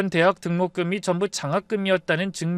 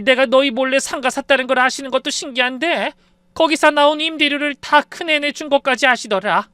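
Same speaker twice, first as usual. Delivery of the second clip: put-on high, loud, flustered older woman; agitated, disbelieving retelling in a raised voice; still-astonished mother-in-law; 6 semitones higher, 4 dB louder than usual. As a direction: pour out the shock as if still reeling. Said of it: "아직도 놀라운지 고개를 절레절레 흔들며 계속 말씀하셨어요".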